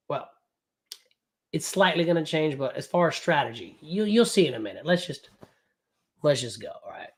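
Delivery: normal talking voice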